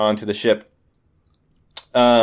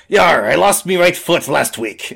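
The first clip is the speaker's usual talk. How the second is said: salty pirate yar; pirate diplomat; 5 semitones higher, 3 dB louder than usual. Acted. Pirate explains her lost foot, pirate voice. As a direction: answer as an old sea dog. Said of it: "She says".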